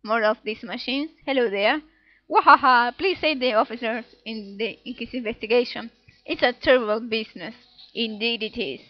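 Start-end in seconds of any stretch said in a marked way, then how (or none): 2.3-2.9 booming villain laugh. Acted. Reading character laugh.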